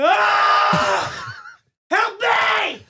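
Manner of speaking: high, loud terrified screaming for help; panicked cry for help. Retold or imitated. Brother wakes hallucinating, screaming high in terror. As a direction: shriek for help like a feverish kid. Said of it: "he woke up and he's like".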